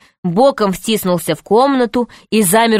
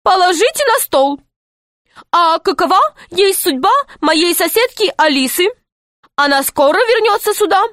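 put-on high, eager boy voice; a bright young boy playing along; 9 semitones higher than usual. Pashka in pirate-speak, voiced high.

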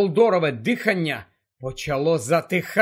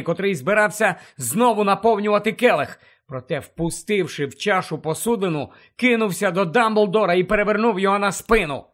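plain reading voice

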